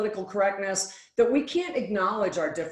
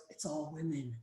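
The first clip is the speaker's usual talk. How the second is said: mumbled despair; 4 semitones lower, 13 dB quieter than usual. Retold or imitated. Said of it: "He goes".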